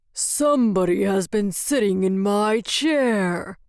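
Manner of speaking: gruff Father Bear growl; growly roar. A narrator's reading. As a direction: roar it like a big bear, without shouting. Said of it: "roared with a growly voice".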